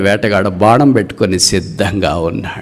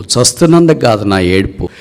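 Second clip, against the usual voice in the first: normal talking voice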